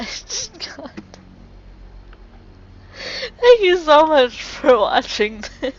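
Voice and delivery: laughing too hard to go on; breaking into laughter. Real, not acted. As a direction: lose it completely, gasping through laughter. Player still laughing at the outro.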